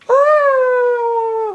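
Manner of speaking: very high, very loud terrified shriek; piercing scream. Real, not acted. Gamer startled in the laboratory, shrieking. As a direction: let out a full shriek.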